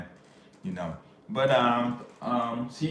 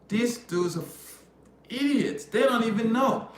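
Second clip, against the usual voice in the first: high-pitched incredulous complaint; retold disbelief, voice pitched up; exasperated; 11 semitones higher than usual. Reacting to guys talking like girlfriends, exasperated.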